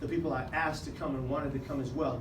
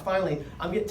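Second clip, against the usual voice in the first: ordinary talk, nothing marked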